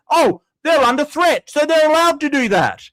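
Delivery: whiny sarcastic parody of the viewer; mocking quote. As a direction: streamer mimicking a chatter's excuse in a sneering voice. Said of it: "move the goalposts and say".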